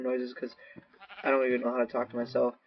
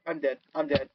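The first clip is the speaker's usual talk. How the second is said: ordinary talk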